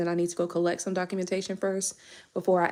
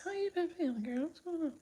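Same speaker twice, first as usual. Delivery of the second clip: much higher, soft cooing to a viewer; sweet high greeting; 10 semitones higher, 11 dB quieter than usual. Real, not acted. Fond high coo asking a chat viewer how she feels. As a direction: coo the question up high and gentle.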